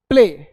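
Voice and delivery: "प्ले" loud and clipped; correct play. Shown as a demonstration. Teacher giving pupils the correct quick play. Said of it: "It's not play".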